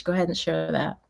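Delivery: usual speaking voice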